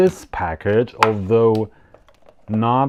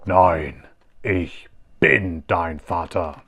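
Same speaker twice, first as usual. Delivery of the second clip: slow, lowered mock-Vader in German; German Darth Vader; 4 semitones lower, slower than usual. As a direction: savour each German word like the dark lord. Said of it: "is what he says in German".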